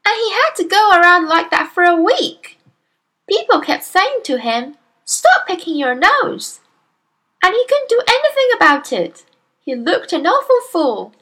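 put-on high, loud, childlike relish; little girl storyteller, artful. A narrator's reading. Daughter finishing her tale to father, little girl voice.

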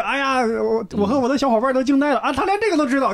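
high, gushing amazement; amazed. Retold or imitated. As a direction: gush over the clever trick. Amazed audience member quoted.

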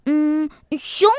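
little fox's eager answer; hum, then pop out the word eagerly; excited. A narrator's reading. Little fox telling mama what it will see, excited. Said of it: "小狐狸兴奋地回答".

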